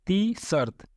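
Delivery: ती शर्त with dental t at start and end; tongue on the teeth for both t's in ती शर्त; Arabic-style ती शर्त. Shown as a demonstration. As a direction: say ती शर्त with no hard retroflex t. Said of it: "इसलिए शर्त बोलते हैं".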